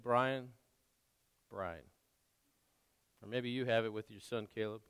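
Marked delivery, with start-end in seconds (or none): 0.0-1.8 gentle. Acted. God gently calling preacher's name.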